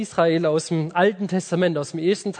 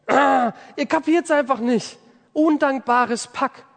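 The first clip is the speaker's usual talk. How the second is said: exasperated thought; 7 semitones higher than usual. Retold or imitated. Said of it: "Und ich denk mir so".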